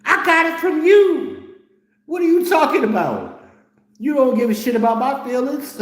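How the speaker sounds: very high, loud mocking reply; shrill mock retort. Son throwing mom's words back, high and loud. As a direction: squeal it back at her.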